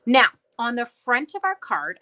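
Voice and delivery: ordinary talk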